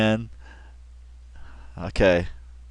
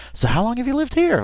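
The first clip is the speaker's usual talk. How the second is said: genuinely interested; 10 semitones higher than usual. Retold or imitated. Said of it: "she's genuinely interested".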